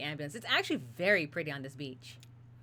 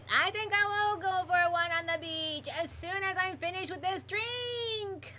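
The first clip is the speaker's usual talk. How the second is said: high, louder yell, slowing at the end; 13 semitones higher, 3 dB louder than usual. Acted. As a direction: call out high, drawing out the last words. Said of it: "yells Lewis".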